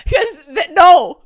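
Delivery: high, loud laugh-crying. Real, not acted. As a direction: laugh so hard it breaks into tears. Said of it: "laughing and crying retelling school".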